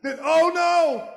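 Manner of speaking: very loud, high, slow oh no; alarmed. A narrator's reading. Storyteller announcing the parents' return, loud and alarmed. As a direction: gasp it out big for the kids.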